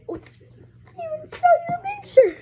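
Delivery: high, fast laughing words; squealing through laughter. Real, not acted. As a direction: giggle it out, embarrassed. Laughing at own forgetting.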